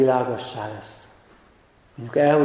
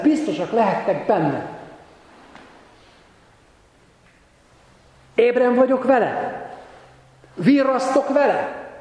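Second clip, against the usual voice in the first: high, ringing exhortation; impassioned preaching; 7 semitones higher than usual. Real, not acted. Preacher calling the congregation to take up the cause, fervent and high. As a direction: urge them on, pitched well up.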